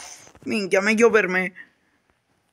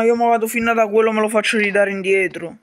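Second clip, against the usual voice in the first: ordinary delivery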